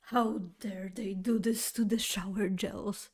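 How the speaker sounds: fuming whisper at the shoppers; outraged whisper. Retold or imitated. Muttering to herself about the ruined gels, whispering. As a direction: hiss it under your breath.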